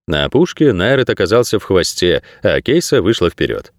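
ordinary delivery

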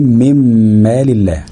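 long hummed m joining من to مال; noon into meem. Shown as a demonstration. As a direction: say من مال الله as "mimmaal", holding the m. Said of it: "مثال النون الساكنة مع الميم".

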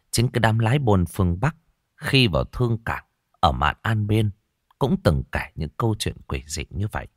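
ordinary delivery

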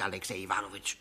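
Alexei Iwanowitsch dropped low and soft; quiet address. Acted. Guest reproaching his host by name, quiet.

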